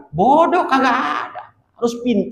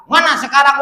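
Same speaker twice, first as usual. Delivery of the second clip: angry demand; 8 semitones higher, faster than usual. Acted. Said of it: "Marah Kangjeng Nabi".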